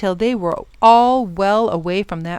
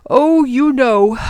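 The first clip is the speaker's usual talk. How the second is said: loud, drawn-out, higher sigh of words; worried professor; 5 semitones higher, 6 dB louder, slower than usual. Acted. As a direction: heave it out wearily. Weary professor foresees more trouble.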